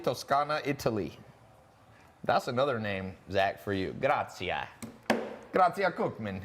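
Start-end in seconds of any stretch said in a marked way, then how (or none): 4.0-6.4 hammed-up fake Italian accent, Grazia rolled as Gratsia; mock Italian accent, rolling the R in Grazia with a ts sound; bad Italian accent. Host reads a viewer's name to Zach in a mock Italian accent. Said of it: "that was a horrible Italian accent I just did".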